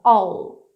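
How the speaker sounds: "all" with the L marked on the palate; touch the roof of the mouth for the L in "all"; marked final L. Shown as a demonstration. Teacher showing students "all" with a marked L. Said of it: "um Lzinho marcando lá no céu da boca".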